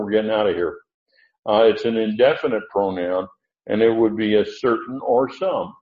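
usual speaking voice

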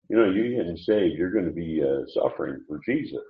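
soft, low aside, as if tapping someone's shoulder; quiet confiding. Pretend. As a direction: drop right down into a hushed mock confidence. Imagined God tapping shoulder to confide.

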